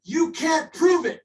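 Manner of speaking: loud, high, slow angry shout; you can't out-argue this, shout it. Real, not acted. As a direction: yell it at the flat earthers, fed up. Heated flat earth argument.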